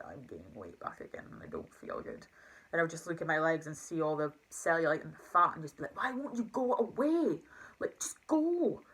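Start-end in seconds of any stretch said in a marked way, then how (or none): 0.1-2.3 much lower, much softer thought; low defeated mumble. Retold or imitated. On holiday feeling disgusting, low soft self-talk. 6.0-8.8 high slow pleading, fading to a whisper. Retold or imitated. Looking at her legs, high slow whispered pleading.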